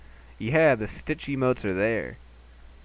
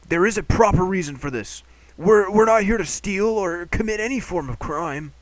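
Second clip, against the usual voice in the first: higher, louder pleading character; 8 semitones higher, 5 dB louder than usual. Acted.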